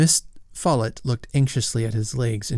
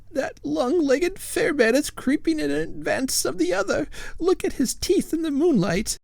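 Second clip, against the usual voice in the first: high, fretful ghost lady voice; a prim lady ghost fussing at her husband; ghostly old lady. A narrator's reading. Lady Follett's voice.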